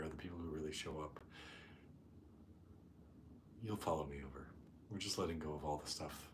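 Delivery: soft, hushed meditation voice with long pauses; guided meditation. Real, not acted. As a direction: settle the group gently, speaking softly and unhurried. Leads a meditation.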